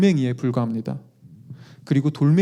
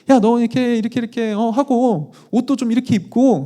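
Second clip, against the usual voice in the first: higher, lightly voiced nagging mom; nagging mother-in-law; 7 semitones higher than usual. Mother-in-law nagging her daughter on a walk, higher mock nagging. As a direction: imitate a mother scolding her daughter about clothes. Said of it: "장모님이 그 처제한테 막 또 잔소리를 하고 있었어요".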